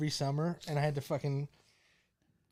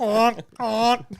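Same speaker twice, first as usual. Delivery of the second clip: high, loud honking goose voice; Wally the Goose; 6 semitones higher, 9 dB louder than usual. Pretend. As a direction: play a silly goose on the phone. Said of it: "You're talking to Wally the Goose".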